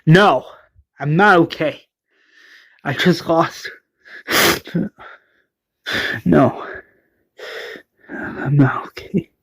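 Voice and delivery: sighing, trailing into a whisper; weakly acted hurt and loss; mock distraught. Enid answers Rose after a loss, distraught.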